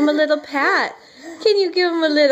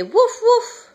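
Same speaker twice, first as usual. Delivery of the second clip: put-on higher playful woof woof for the baby; playful dog bark imitation; 7 semitones higher, 3 dB louder than usual. Shown as a demonstration. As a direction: bark like a friendly puppy.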